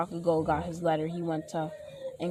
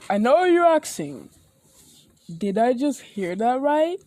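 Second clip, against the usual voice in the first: loud, raised hero voice; All Might; 6 semitones higher, 6 dB louder than usual. Acted. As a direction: boom it out, high and loud. Acting All Might's message.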